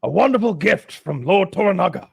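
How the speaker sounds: high, grandly pleased Englishman; heartily over-acted thanks for a gift; Englishman's hearty proclamation. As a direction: proclaim it like a gracious host. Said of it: "and he's like".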